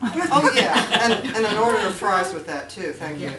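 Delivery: loud, laughing mock order at a drive-through; mock fast-food order; laughing sarcasm. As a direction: blurt it out laughing at the absurdity. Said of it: "sarcastic mock reply".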